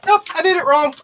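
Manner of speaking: high, loud frustrated outburst; loud exclamation. Real, not acted. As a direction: blurt it out loudly. Builder realizes mistake aloud.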